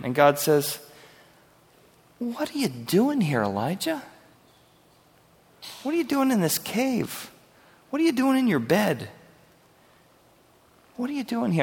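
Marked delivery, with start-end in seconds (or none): 2.2-11.6 high, soft and tender questioning; God gently asking Elijah what he's doing; gentle God. Acted. God gently prodding Elijah in his cave, soft and high. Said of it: "you just see the gentleness of God".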